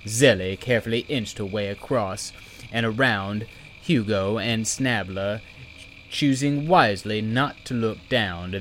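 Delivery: ordinary delivery, nothing marked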